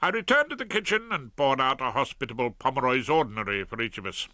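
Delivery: plain reading voice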